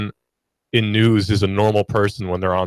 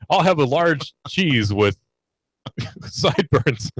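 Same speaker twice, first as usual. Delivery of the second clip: slow, higher ad-man delivery with a laugh; seventies ad narrator; 4 semitones higher, slower than usual. Acted. Radio host ordering pizza in his broadcast voice, ad narrator style. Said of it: "nineteen seventies ad, ad narrator".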